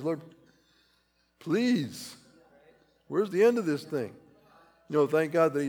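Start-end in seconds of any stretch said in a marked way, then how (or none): 0.0-4.1 higher, strained plea to God; pleading prayer. Retold or imitated. Preacher voicing his own weary prayer, strained plea. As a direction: cry out weary of it. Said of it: "I've done it in some areas".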